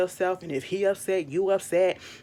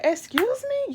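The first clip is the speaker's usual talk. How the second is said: indignant excuse me; slower than usual. Retold or imitated. Indignant retort to castmate.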